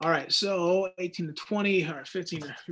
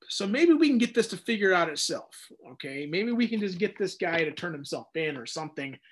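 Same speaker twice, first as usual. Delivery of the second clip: normal talking voice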